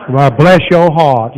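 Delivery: slow, drawn-out Southern "Well, bless your heart". Pretend. Preacher mimicking a Southerner for the crowd, slow Southern drawl. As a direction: drawl it sweetly like a Southern lady. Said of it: "you know".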